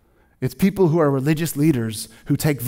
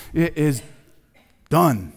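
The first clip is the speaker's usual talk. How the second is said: mild victor's cry; slower than usual. Retold or imitated. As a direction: finish strong on done. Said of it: "it's a victor's cry".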